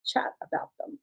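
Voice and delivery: hoarse, scratchy voice. Real, not acted. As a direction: talk through a scratchy throat. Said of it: "Sorry for my scratchy voice".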